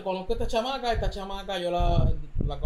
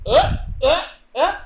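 mock scream; 9 semitones higher, 7 dB louder, slower than usual. Screaming in retold dream chase.

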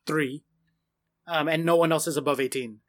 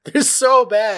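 high, loud lament; exaggerated despair; 6 semitones higher, 7 dB louder, much slower than usual. Real, not acted. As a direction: echo the wail, louder. Echoing the despair.

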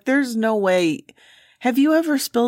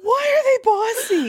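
high, slow squeal of amusement; laughing question. Real, not acted. Host asks co-host about bossy ants, high and amused. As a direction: ask it while cracking up.

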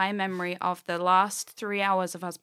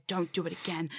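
dummy face; 3 semitones lower, 5 dB quieter than usual. Retold or imitated. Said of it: "And I did my dummy face".

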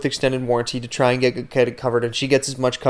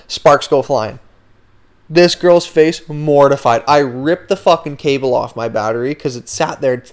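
normal talking voice

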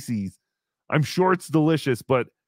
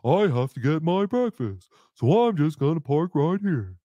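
mock pompous friend; 3 semitones lower than usual. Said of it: "Cardiff decided".

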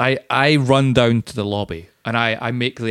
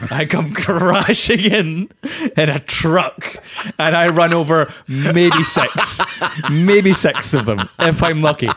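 excited retelling; 4 semitones higher, slower than usual. Pretend. Friend narrating his truck crash into gremlins, excited. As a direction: savour the big entrance, slowly.

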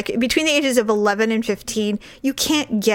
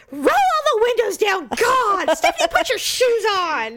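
higher, mock shouted orders; exasperated mom; 9 semitones higher than usual. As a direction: play the fed-up mother. Mother voiced in car.

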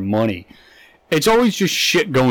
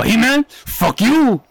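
mock tough guy; 9 semitones higher, slower than usual. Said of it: "walking over and go".

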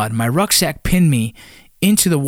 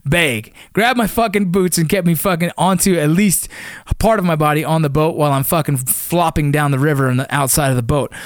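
normal talking voice

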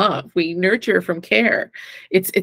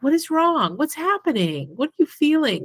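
higher, concerned parent voice asking gently; caring parent; 7 semitones higher than usual. Retold or imitated. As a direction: ask like a worried, tender parent. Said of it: "you'd wanna seek".

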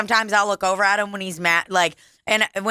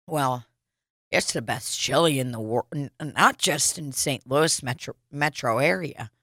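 low, soft mock boast; lowered, hushed send-up of a bragging regular; low and soft, smug and certain; imitating Donald; 7 semitones lower, 5 dB quieter than usual. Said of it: "He goes".

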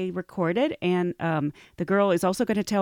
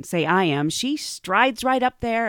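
usual speaking voice

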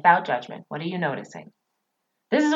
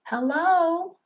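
mock hello; 10 semitones higher than usual. Mock calling out to listener.

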